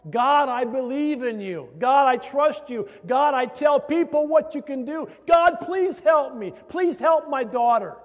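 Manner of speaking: high, loud, impassioned plea; crying out to God. Retold or imitated. Mother begging God for her afflicted daughter, crying out. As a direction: plead upward in a building cry. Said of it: "the mother started crying out to God".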